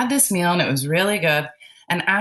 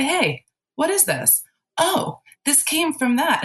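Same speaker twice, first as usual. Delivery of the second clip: high-pitched self-questioning, then realization; curious discovery; 9 semitones higher than usual. Acted. Working with your own self, high and curious. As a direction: act out finding the answer, voice lifted.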